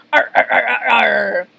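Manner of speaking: barking arr-arr cackle; gloating chain of arrs; pirate laugh. Acted. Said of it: "Mister Krabs stops laughing".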